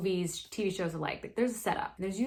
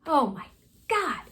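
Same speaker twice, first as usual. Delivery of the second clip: loud, high, split into dramatic beats; shocked exclamation; 8 semitones higher, 8 dB louder than usual. Real, not acted. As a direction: gasp out each part in disbelief. Reacts to the gun going off.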